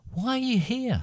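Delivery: put-on very high little girl question; young girl's voice. A narrator's reading. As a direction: pitch it way up as the girl. Narrator voices the girl Spoon asking.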